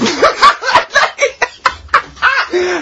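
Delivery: long, high, breathy cackle; high shrieking laugh. Real, not acted. Laughing at the toe massage idea.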